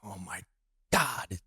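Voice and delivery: hushed, stunned oh my God; awed whisper. Retold or imitated. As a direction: breathe it out in quiet awe. Awed reaction to shoe story.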